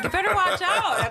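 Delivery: playful high teasing threat, laughing; mock warning. Co-host teasing the guest about taking the host's seat, playful mock threat. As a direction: warn him off with a laugh. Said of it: "I was like".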